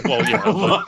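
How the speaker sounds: high laughing yeah. Real, not acted. Caught out, laughing at a friend's joke. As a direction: give in with a laugh.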